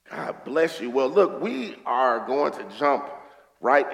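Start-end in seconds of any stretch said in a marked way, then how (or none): none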